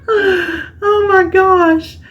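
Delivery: very high, very slow, laugh-cry; giggling oh my gosh at herself; helpless laughter. Real, not acted. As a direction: stretch it out, laughing in disbelief. Laughing at her planning mix-up.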